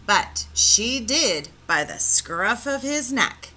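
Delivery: ordinary delivery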